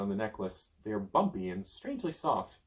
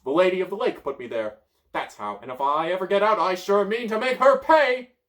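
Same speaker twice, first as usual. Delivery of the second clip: high, loud, building threat; vengeful wizard; 8 semitones higher, 11 dB louder than usual. Acted. Merlin threatening the Lady of the Lake, loud and high. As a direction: vow revenge.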